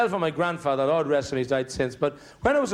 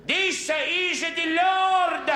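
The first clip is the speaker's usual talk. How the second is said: very slow, much higher hammed-up God voice; a painter trying to fool the praying woman; mock voice of God; much slower than usual. Shown as a demonstration. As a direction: drag out every word, overdoing the heavenly voice. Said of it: "I'll try".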